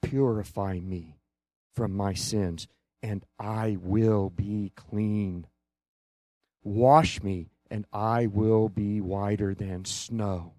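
low, hushed penitent prayer; subdued cry to God. Acted. Preacher praying for cleansing on the church's behalf, hushed plea. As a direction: plead softly and low, as if broken before God. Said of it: "we cry out to him and say".

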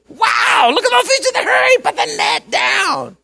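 high, loud calling out across the boat; hammed-up cry to put the net down; excited shout. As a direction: shout it like a fisherman spotting a catch. Fishermen calling out, acted.

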